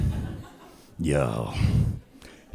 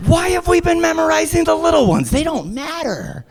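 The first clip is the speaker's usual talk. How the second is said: mock indignant. Real, not acted. Mock outrage at alphabet.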